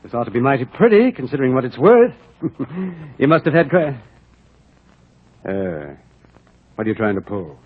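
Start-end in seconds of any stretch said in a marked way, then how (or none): 2.4-3.1 chuckle. Acted. Buyer chuckles over the canvas.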